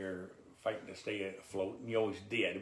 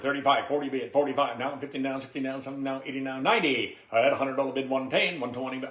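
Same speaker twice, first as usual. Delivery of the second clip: loud auctioneer bid chant; 4 semitones higher, 9 dB louder than usual. Shown as a demonstration. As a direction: call the bids like a live auction. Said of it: "Give me an example".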